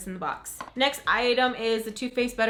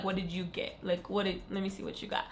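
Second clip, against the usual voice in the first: whispering; 2 semitones lower, 8 dB quieter than usual. Real, not acted. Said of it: "conspiratorial asking".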